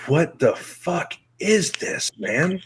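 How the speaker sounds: high, drawn-out retold disbelief; baffled disbelief. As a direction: stretch it out high, utterly baffled. Retold baffled reaction to a playlist.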